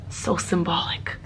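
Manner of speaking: mock-solemn whisper; a hushed, hammed-up aside. Real, not acted. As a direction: breathe it like a grand revelation.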